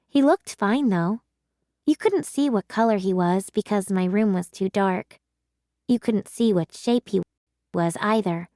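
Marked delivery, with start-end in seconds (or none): none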